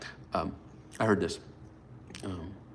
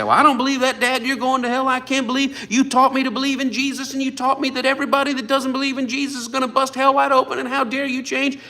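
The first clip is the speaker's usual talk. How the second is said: put-on much higher, pleading child's outrage; accusing kids; 13 semitones higher, 5 dB louder than usual. Kids condemning their father, a high accusing voice. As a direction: play the upset child scolding Dad. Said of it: "my kids who say".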